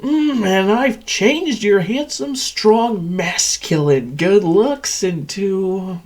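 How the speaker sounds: high, drawn-out fawning; mock admiration. Machine praises captive's former looks, mock admiration. Mocks victim's lost looks.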